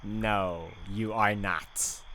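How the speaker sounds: ordinary talk, nothing marked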